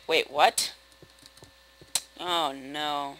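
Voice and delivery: ordinary talk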